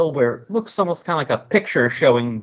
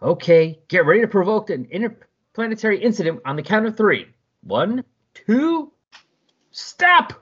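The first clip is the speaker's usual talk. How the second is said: higher and louder up to a yelled "stop!"; building shout; 8 semitones higher, 3 dB louder than usual. Acted. Stealth counting the Legionnaires into a fight, building to a shout.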